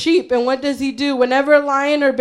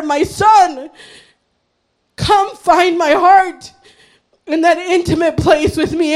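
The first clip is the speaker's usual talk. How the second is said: fervent, raised preaching voice; the Lord's appeal; 3 semitones higher than usual. Acted. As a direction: call them home with urgent conviction. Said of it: "that's what the Lord is saying".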